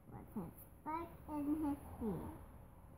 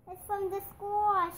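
high, raised-voice mock shriek; 8 semitones higher, 9 dB louder than usual. A narrator's reading. Woman spotting a bear from the school, mildly shrieking. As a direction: read it as a high, alarmed cry. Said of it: "she shrieked".

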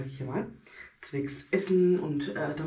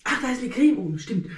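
ordinary talk, nothing marked

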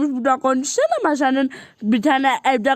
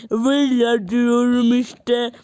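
usual speaking voice

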